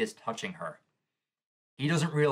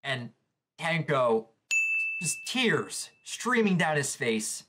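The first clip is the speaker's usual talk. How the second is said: ordinary talk, nothing marked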